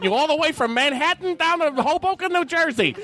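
put-on old-time radio announcer. Reporter hyping the winner for listeners, brisk old-time radio patter. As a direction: gush like a 1938 CBS newsman. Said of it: "they talk like this the whole goddamn time".

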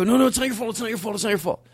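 high-pitched, frantic repeated reassurance; strained reassurance. Retold or imitated. Guest telling clerk it's not her fault, strained. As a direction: rattle it off high, barely holding it together.

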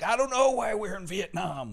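high-pitched mock helplessness; mock defensive. Backpedalling to foreigners about the war, mock defensive. Acted defensive American put on spot.

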